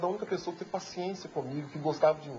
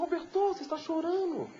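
tender madame; 11 semitones higher than usual. Retold or imitated. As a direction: say it gently as the madame, pitched up. Madame sees the boy crying. (madame, voz aguda e suave)

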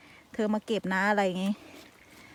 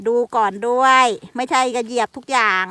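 louder, firm caution; retold warning; 8 dB louder than usual. Retelling Mika warning her, in a louder firm tone. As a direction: warn him not to step on everything. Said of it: "มิกะก็ยังปรามนะคะว่า".

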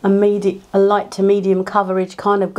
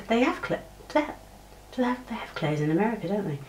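quiet musing; 2 semitones higher, 10 dB quieter, faster than usual. Real, not acted. Trailing uncertain question, very quiet.

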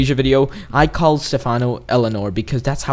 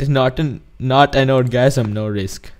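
put-on Indian accent, dropping off at the end; 3 dB quieter than usual. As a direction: finish the Indian-accent reading more softly. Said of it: "script of it in an Indian accent".

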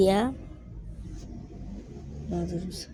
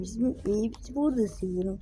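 ordinary talk

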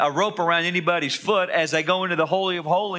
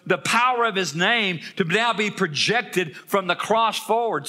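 rising preaching; 4 semitones higher than usual. Acted. Pastor rallying the congregation at full preaching force. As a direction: drive the refrain home to the congregation.

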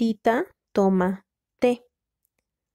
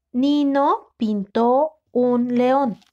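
slow, with each word drawn out and distinct; slow dictation; slower than usual. Shown as a demonstration. Teacher dictating the sentence to a student, slowly and clearly. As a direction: read it out slowly so they can write it.

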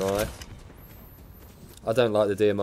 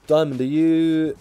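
slow, higher put-on accent on Diamond; drawn-out joke accent; 5 semitones higher, 5 dB louder, slower than usual. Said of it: "I don't know why I did the accent".

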